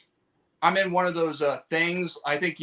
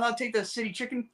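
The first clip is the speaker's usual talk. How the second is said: high, soft customer voice; a hint of a high-voiced customer; lightly acted customer; 7 semitones higher, 7 dB quieter than usual. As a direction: lightly imitate someone placing an order, pitched up. Customer in acted restaurant bit.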